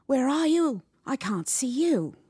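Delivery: high, loud panicked cry; frightened. A narrator's reading. Trapped boy calling to a hidden voice, frightened. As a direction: call out wildly to someone unseen.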